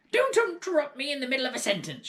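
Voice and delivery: put-on high, loud and fast old woman's shout. A narrator's reading. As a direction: rattle it off at him like a furious grandmother. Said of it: "she shouted".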